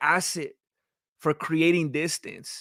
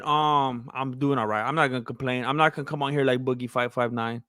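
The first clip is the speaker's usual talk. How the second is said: normal talking voice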